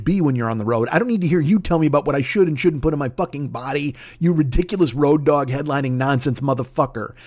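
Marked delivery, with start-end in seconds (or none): none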